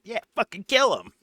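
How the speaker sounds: high mock tough guy bravado; fake tough guy swagger. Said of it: "fake tough guy voice".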